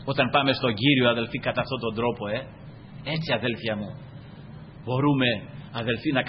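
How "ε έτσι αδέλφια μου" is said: usual speaking voice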